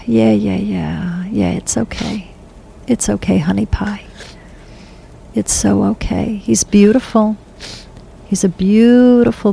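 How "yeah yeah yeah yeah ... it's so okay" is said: soft, hushed comfort for someone in tears; gently calm her down, almost a whisper; soothing murmur. Real, not acted. Medium soothing a weeping sitter, soft and hushed.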